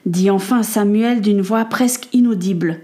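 ordinary delivery, nothing marked

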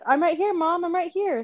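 put-on high-pitched kid answering mom; imitated child. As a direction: pipe up as a little kid. Mother voices her child answering.

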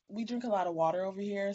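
normal talking voice

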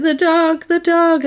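high-pitched mock panic; distraught. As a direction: imitate her frantically crying out for the pet. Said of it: "she's distraught".

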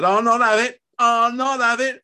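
loud, high, stubborn fury; bellowing. A narrator's reading. Husband refusing to accept it, bellowing. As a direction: roar your refusal. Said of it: "Fred bellowed".

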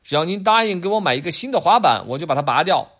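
name your price like a little dealer; bargaining kid. Acted. Adam bargaining for a skateboard, cheeky child voice. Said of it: "亚当说".

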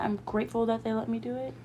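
ordinary talk

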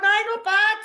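high, giggly aye not bad; chuckling cheery reply; laughing reply. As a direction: answer while laughing. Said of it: "We're going".

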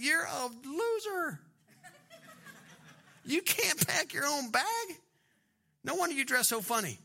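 mock scorn. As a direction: tease a pampered buddy in disbelief. Mock put-down of pampered buddies.

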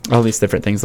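ordinary talk

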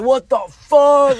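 loud, high, drawn-out disbelief; retold dismay. Telling a friend how Kenny turned up, retold dismay.

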